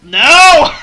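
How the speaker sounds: loud high shouted no; sudden shout. Acted. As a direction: blurt it out loud. Sudden outburst at menu.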